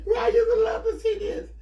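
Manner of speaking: high, loud, half crying with laughter; laughing shout. Real, not acted. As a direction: squeal it out through laughter. Laughing hard at cousin's story.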